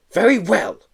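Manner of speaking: high, loud irritated snort; irritable snort. Acted. Teacher to the boy, loud and irritated. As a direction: snap it out, fed up. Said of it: "the teacher snorted irritably".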